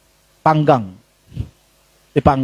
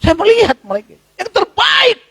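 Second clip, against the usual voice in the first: high, louder full-force proclamation; preaching shout; 3 dB louder than usual. Acted. Preacher to congregation, climax of the point, shouting. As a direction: shout it to the back row.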